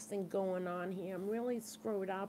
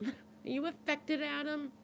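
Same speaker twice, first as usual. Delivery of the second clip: teasing mock question; 8 semitones higher than usual. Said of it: "Adam, what does it feel like to be the middle child?".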